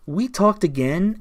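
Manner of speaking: ordinary talk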